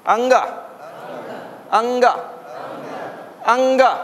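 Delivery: anga with a nasal ng, then a; teacher's model anga. Shown as a demonstration. Teacher modelling anga for the class. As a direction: say anga, ng from the nose, then open a.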